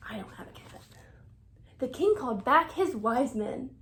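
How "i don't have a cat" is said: soft whisper; whispered confession. Acted. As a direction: whisper it like a little secret. Aside to the listening child.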